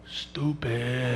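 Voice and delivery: softly leaned-in taunt; taunting aside. Retold or imitated. Comic taunting the six-year-old, soft leaned-in jab. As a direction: lean in close and say it low. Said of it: "I lean in and I go".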